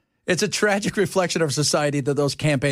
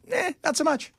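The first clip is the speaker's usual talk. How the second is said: dismissive; 11 semitones higher than usual. Acted. Mock dismissive.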